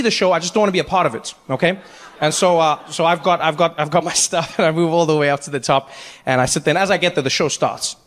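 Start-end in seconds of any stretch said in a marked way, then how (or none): none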